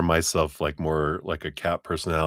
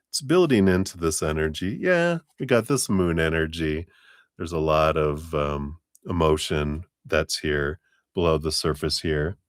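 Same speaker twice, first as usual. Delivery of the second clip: normal talking voice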